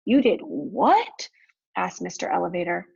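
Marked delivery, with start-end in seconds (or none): 0.1-1.3 slow, incredulous question with swooping pitch; astonished question. A narrator's reading. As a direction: draw it out in disbelief. Father startled by Elmer's news.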